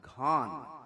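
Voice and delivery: "घान" said as ghaan, voiced breathy gh and long aa; Tibetan pronunciation. Shown as a demonstration. Lecturer shows how the word sounds in Tibet: "घान" with breathy gh. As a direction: set apart and carefully sounded "घान". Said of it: "इसका pronunciation हुआ".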